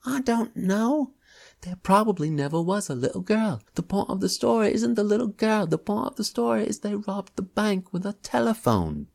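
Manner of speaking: put-on young man crowing about the phone robbery, loud; excited shout. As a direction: shout it like he's thrilled by the scam. Crook keeps ranting.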